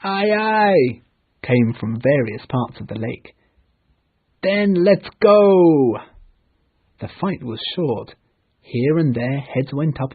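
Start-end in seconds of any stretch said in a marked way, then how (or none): 0.0-1.0 higher loud ready reply; eager distant response; boys answering. Acted. 4.4-6.0 loud, slow higher charge; rousing attack shout; battle cry. Acted. Peter launching the attack.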